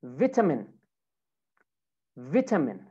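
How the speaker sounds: British "vitamin" with short "vit" first syllable; British accent. Shown as a demonstration. Teacher models British "vitamin" for students, short first vowel.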